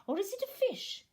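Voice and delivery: a bear pretending to wonder; Pooh, wondering. Pooh speaks.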